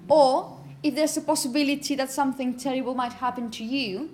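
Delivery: ordinary talk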